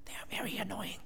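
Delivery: breathy stage whisper; whispered aside. Real, not acted. Preacher whispers an aside to the church about annoying people. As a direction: lean in and whisper it. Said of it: "whispered aside".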